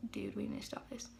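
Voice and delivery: urgent hushed whisper; scared whisper. Retold or imitated. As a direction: whisper it urgently to your cousins. Scared during automatic writing.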